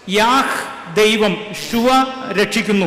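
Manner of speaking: very slow, each part set apart: yeah then ഷുവ; slow explanatory breakdown of the name യാഹ്ഷുവ; spelling out the name. Shown as a demonstration. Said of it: "പേരാണ് യാഹ്ഷുവ".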